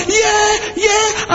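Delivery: high shouted yeahs; joyful cheer. Retold or imitated. Fans around him celebrating the win, high shouted cheers. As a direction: cheer with the crowd around you. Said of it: "the other people around me were like".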